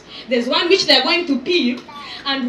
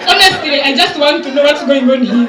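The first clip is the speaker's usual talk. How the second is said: loud, laughing call-out to a table; laughing tease; 8 dB louder than usual. Real, not acted. MC teasing a table of gentlemen, laughing loudly. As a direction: tease the men through laughter.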